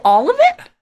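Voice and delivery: can't believe what you heard; stunned question. Retold or imitated. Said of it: "I go".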